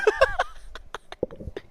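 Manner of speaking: quiet high giggling fit; real laughing fit. Real, not acted. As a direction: laugh helplessly while trying to go on. Cracks up trying to continue.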